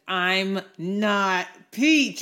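put-on slow, loud, offended child protest; indignant. Little girl snapping at a friend, offended. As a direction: insist you're not peach, affronted.

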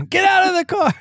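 mock yell. Driver throws her out, joking.

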